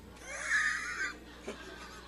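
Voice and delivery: high shrieking burst of laughter; laughing hard at his own punchline; big laugh. Real, not acted.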